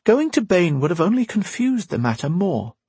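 a hint of a wise old teacher; Windy's voice. A narrator's reading.

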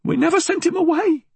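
put-on high, quick old-woman protest; high-pitched indignant denial. A narrator's reading. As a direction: deny it urgently, pitched very high. Old woman protests under threat.

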